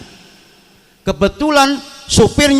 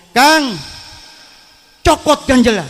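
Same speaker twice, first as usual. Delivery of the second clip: Sundanese driver's shout; 6 semitones higher, slower than usual. Acted. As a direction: call out an order as the truck driver. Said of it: "supirnya teriak lagi". (teriakan supir Sunda)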